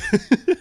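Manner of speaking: a sudden high, loud laugh; burst of laughter. Real, not acted. Vlogger cracking up at the comic's Peacemaker, loud laugh.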